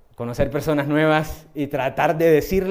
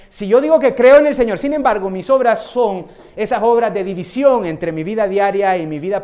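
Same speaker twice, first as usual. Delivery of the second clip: preaching at full force; 4 semitones higher, 8 dB louder than usual. Acted. Preaching climax.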